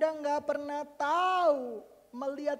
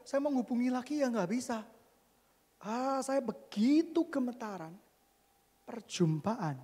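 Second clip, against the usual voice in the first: normal talking voice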